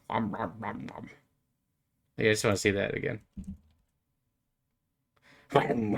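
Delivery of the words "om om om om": soft munching om om; munch quietly like a cartoon; eating noises. Watching character eat.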